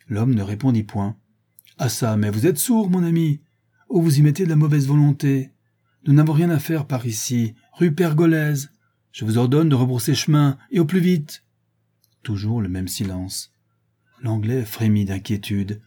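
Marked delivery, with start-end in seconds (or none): 1.8-11.4 louder, higher angry commands; angry orders. A narrator's reading. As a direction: lose patience and bark at the driver. Sholmes s'emporte contre le chauffeur. (Sholmes furieux donne l'ordre au chauffeur)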